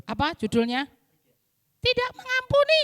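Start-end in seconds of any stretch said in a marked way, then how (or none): 1.8-2.8 high puppet answer; Pinky puppet voice. Acted. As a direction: guess wrong like the puppet. Puppet gets title wrong.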